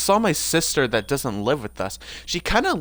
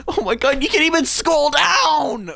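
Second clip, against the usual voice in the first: exasperated wail; 9 semitones higher, 7 dB louder than usual. Real, not acted. Gamer discovering the screen cannot scroll, high exasperated shout. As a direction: wail it in exasperation, stretching the words.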